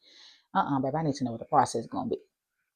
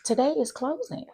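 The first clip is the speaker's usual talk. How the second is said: high, sweet matter-of-fact agent voice; high singsong agent reply; title agent; 14 semitones higher, slower than usual. Retold or imitated. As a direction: chirp it like the breezy rep. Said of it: "she's like".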